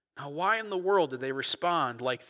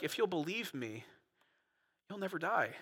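tender Jesus; 3 semitones higher, 7 dB quieter than usual. Acted. Jesus voiced gently after shouting.

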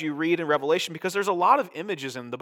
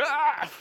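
high mock shriek of fright; startled high yelp; mock scream; 12 semitones higher than usual. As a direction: squeal like a man about to die, hammed up. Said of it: "and he's like".

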